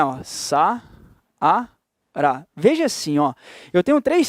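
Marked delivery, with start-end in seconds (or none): 0.2-1.7 "Sa-a-ra" in three slow, separate syllables. Shown as a demonstration. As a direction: break "Sa-a-ra" apart with a clear pause after each syllable. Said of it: "caso eu faça a separação silábica".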